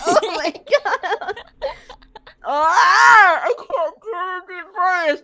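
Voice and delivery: panicked. Real, not acted. Panicking before performing.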